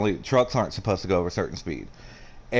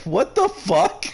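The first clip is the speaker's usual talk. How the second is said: friend's stunned reaction; 14 semitones higher, slower than usual. Retold or imitated. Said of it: "my friend was like".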